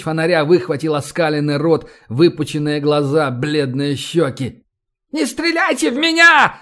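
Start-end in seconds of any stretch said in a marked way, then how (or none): 5.1-6.6 very high, frantic shriek; squealing in panic. A narrator's reading. Fleeing man begging soldiers not to shoot, panicked squeal. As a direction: shriek for your life. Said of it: "завизжал человек".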